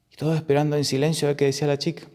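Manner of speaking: ordinary delivery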